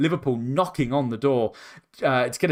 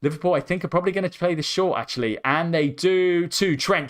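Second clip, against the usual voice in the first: ordinary talk, nothing marked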